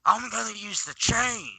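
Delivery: high-pitched, hammed-up threat; mock motherly threat. Mother threatening her grown son, mock outrage.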